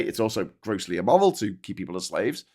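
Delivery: ordinary talk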